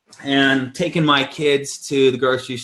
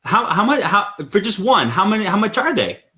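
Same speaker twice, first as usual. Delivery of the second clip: pitched-up, laughing disbelief; a half-laughing double take at the price; stunned disbelief; 7 semitones higher than usual. Retold or imitated. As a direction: ask the price again, can't believe it. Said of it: "And I'm like".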